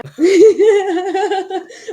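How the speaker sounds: high, loud burst of laughter; real loud laugh. Real, not acted. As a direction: crack up out loud. Laughing at her own joke.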